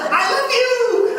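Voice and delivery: very high, loud, slow squeal; gushing little girl. Retold or imitated. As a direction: squeal it like an adoring child. Acting the girl's instant adoration.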